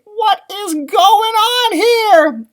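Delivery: high, slow astonished cry; startled cry. A narrator's reading. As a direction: wail the question in shock.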